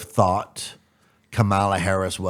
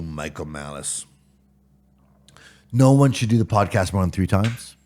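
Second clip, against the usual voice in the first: ordinary delivery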